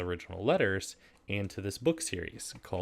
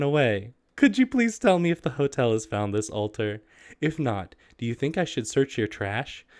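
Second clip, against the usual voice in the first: higher, mock-formal letter reading; raised, overly proper read-aloud voice; 6 semitones higher, 8 dB louder than usual.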